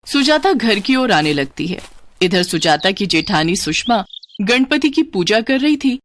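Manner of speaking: ordinary delivery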